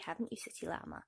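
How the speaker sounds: usual speaking voice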